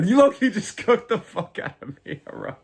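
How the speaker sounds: laughing while owning up to being roasted. Real, not acted. Streamer to chatter Haro, laughing at being roasted. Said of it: "you just cooked the fuck out of me".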